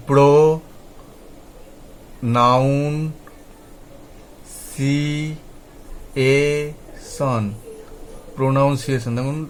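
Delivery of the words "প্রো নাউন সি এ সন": "প্রো, নাউন, সি, এ, সন" with long pauses between syllables. Shown as a demonstration. Teacher breaking a long word down for learners, syllable by syllable. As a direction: draw out each piece of "প্রো, নাউন, সি, এ, সন" separately. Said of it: "একটু ভেঙে ভেঙে কীভাবে উচ্চারণ করে".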